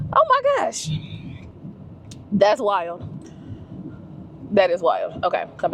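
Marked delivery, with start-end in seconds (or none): 0.1-0.9 high excited squeal; squeal of surprise. Real, not acted. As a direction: shriek with delight. Amazed at the restaurant.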